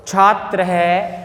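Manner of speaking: each syllable drawn out slowly; teacher's slow model reading; slow dictation. Shown as a demonstration. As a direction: say it slowly for the class to repeat. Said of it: "उच्चारणम कुरत".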